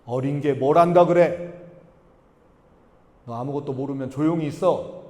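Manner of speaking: mild, low-pitched rebuke; dismissive older brother. Acted. Preacher voices Cain snubbing his brother.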